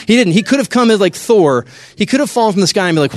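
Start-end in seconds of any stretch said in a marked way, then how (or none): none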